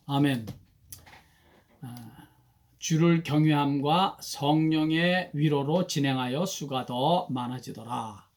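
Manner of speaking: ordinary delivery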